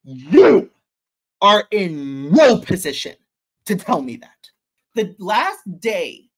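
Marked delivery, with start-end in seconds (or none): 0.0-4.5 loud, cutting rebuke on You; firm, loud refusal; retold angry retort. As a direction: put him in his place.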